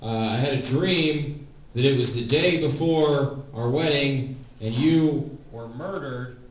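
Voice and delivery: ordinary talk, nothing marked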